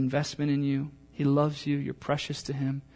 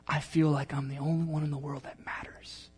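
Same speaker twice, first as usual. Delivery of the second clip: intimate whisper; 6 dB quieter than usual. Real, not acted. Intimate, unvoiced.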